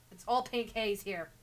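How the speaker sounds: usual speaking voice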